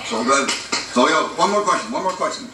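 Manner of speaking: ordinary delivery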